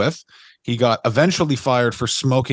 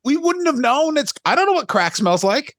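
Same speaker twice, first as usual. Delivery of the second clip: much higher, incredulous pleading; exasperated defense; 9 semitones higher than usual. Retold or imitated. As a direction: stick up for the guy in disbelief. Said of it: "Like, I was like".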